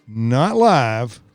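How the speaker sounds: ordinary talk, nothing marked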